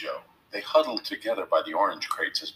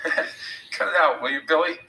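higher voice between nervous chuckles; nervous chuckling; 8 semitones higher than usual. A narrator's reading. Tom pleading with menacing Billy, chuckling nervously. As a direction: chuckle uneasily as you plead. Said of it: "chuckling nervously".

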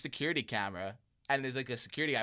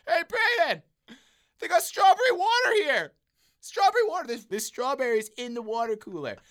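much higher, loud thrilled call; excited cousin; 13 semitones higher, 8 dB louder than usual. Retold or imitated. Cousin calling Braden about strawberry water, excited. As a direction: call out amazed like a kid.

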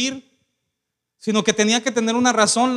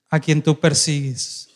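solemn reply; 6 semitones lower, slower than usual. Acted. Jesus answers Saul.

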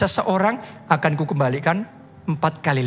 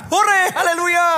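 high, drawn-out hallelujah cheer; acted burst of joy; jubilant cheer; 12 semitones higher, 4 dB louder, slower than usual. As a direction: celebrate it out loud, slowly. Acts out his joyful response.

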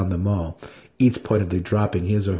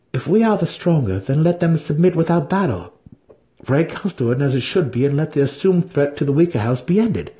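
Drizzt; 6 semitones higher, 3 dB louder than usual. A narrator's reading. Drizzt's plea.

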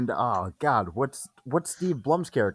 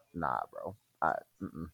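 ordinary talk

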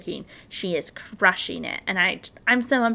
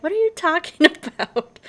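laughing confused; 7 semitones higher, 5 dB louder than usual. Retold or imitated. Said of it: "people are like".

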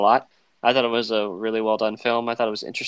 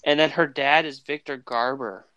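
normal talking voice

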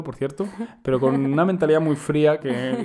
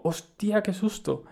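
higher, sudden startled cry; startled exclamation; 7 semitones higher, 4 dB quieter, much slower than usual. Retold or imitated. Retelling his fright at the crutch, a startled high outcry. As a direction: blurt it out in a fright. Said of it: "O sea, fue más como un".

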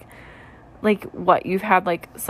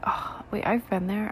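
hushed; 7 dB quieter than usual. Retold or imitated. Hushed inner realization.

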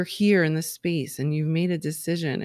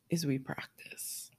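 whisper; 2 semitones lower, 12 dB quieter than usual. Real, not acted. Stressing the second step.

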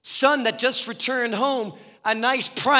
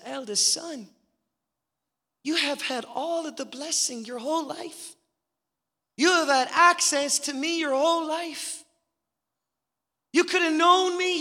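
pleading, loving father's voice, rising high on known and walked; tender father; 7 semitones higher than usual. Acted. Father pleads with his resentful eldest son, tender and high. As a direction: gently remind the eldest son he was always loved.

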